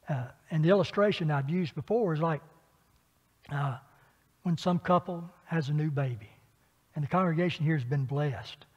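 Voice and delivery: ordinary delivery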